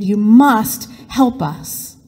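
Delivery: slow, drawn-out "you must help us"; slow desperate plea. Acted. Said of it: "Joseph repeated".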